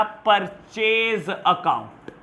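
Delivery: Purchase account drawn out very slowly; slow example term. Shown as a demonstration. Teacher giving the class an example term, slowly.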